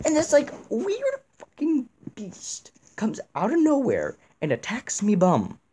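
high-pitched mimicry of a fellow gamer; Jack impression. Gamer recounting a beast attack as Jack, high impression. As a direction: push the put-on voice way up high. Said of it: "I'm gonna do this in Jack's voice".

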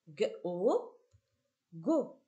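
hard "gue", then "o", then blended into "Go"; teacher blending "G-O" into "Go"; sounding out syllables. Shown as a demonstration. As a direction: say the two sounds apart, then join them as "Go". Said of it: "on va passer à la combinatoire".

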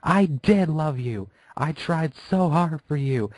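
high drawn-out hateful shout; hateful scream. Acted. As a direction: scream it back with seething hate. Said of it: "seething hate ... as she screamed".

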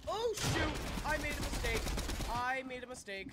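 loud, high cry of alarm; high-pitched shout on realising a blunder; panicked shout. Real, not acted. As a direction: yell it in sudden panic. Realises blunder mid-fight.

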